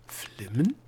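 questioning, with a clear rise; puzzled echo. A narrator's reading. Gnome hearing an unknown word, puzzled.

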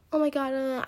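lightly put-on valley girl. Teen catching herself doing a valley girl voice. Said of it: "trying to sound like a valley girl".

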